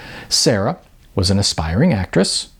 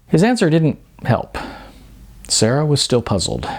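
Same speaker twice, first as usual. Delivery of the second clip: ordinary delivery, nothing marked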